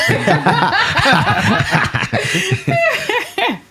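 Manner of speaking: loud high laugh. Real, not acted. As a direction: burst of high-pitched laughter. Joke about food coming out.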